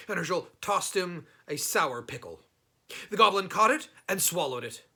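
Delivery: ordinary delivery, nothing marked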